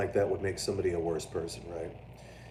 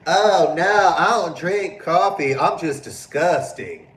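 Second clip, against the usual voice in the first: high, loud, slow prissy mockery; mocking friends; 8 semitones higher, 10 dB louder, slower than usual. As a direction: parody a fussy friend who refuses coffee. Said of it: "I got friends that are like".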